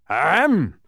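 loud, high "ahem"; booming official ahem; roared throat-clearing. A narrator's reading. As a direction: clear your throat for attention. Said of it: "roared the gateman, clearing his throat".

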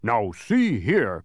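slow, growled objection; angry growl. A narrator's reading. Watchdog Tock objecting to the charges, angry growl. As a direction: growl it out, drawn long. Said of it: "growled Tock angrily".

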